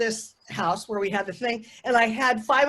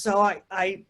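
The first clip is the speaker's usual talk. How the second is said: normal talking voice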